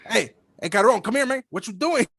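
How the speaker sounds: calling a friend over on the block; hailing a buddy in a street accent. Retold or imitated.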